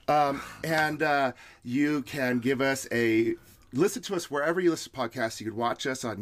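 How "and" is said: usual speaking voice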